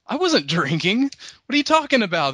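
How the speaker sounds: indignant protest. Acted. Captain accused of drinking protests, high and loud. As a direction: pitch jumps high and loud in outraged disbelief.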